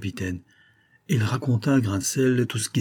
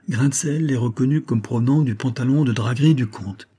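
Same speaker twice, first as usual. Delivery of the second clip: plain reading voice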